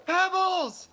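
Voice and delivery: put-on high, loud child calling a cat; excited kid. Kid sees the lost cat, high excited call. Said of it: "And he goes".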